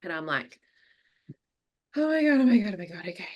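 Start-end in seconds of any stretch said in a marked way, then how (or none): 2.0-2.7 panicked. Retold or imitated. Panic as dog screams. 2.8-3.3 much softer, breathy whisper; whispered self-calming. Retold or imitated. Vet nurse whispering to herself while the dog yelps.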